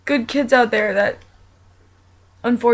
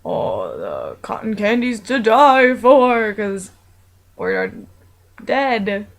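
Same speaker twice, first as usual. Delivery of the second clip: goofy monster voice; slower than usual. Acted. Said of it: "I was like".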